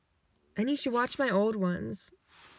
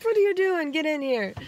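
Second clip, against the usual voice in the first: mock call; 6 semitones higher, 4 dB louder than usual. Calling kid into house.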